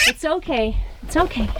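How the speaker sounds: high, gentle cooing. Real, not acted. Calming the fledgling.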